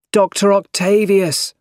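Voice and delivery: high, urgent call. A narrator's reading. Spider-Man calls to the villain, calling out. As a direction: call it out high to reach him. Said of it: "called Spider-Man".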